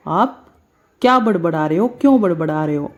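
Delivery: ordinary talk, nothing marked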